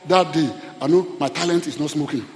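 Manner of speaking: usual speaking voice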